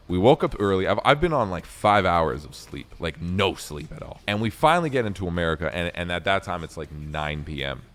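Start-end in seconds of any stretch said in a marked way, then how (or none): none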